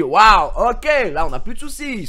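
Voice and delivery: loud, high cry of delight at the good news; excited outburst. Retold or imitated. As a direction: burst out with sudden excitement. Retold joy at the news. (explosion de joie, très fort)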